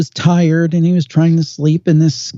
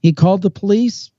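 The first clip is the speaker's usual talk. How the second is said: ordinary talk, nothing marked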